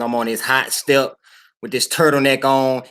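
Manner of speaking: normal talking voice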